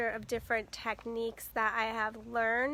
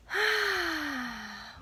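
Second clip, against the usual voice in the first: long, audible exhale; long sigh; 3 semitones higher than usual. Real, not acted. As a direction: let out a big settling sigh. Settling before the introduction.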